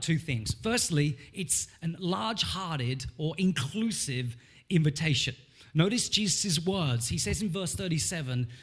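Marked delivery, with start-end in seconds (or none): none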